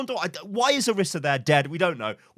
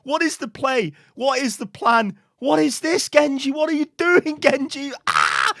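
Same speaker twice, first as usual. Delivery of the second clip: high, squealed disbelief building to a loud yell; exasperated outburst; 13 semitones higher, 4 dB louder, slower than usual. Real, not acted. Gamer to a feeding Genji, exasperated outburst. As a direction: cry out in real exasperation, ending on a yell.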